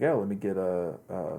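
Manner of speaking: normal talking voice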